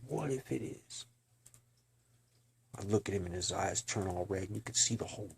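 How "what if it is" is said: sinister demon voice. Retold or imitated. Doctor reveals demonic nature.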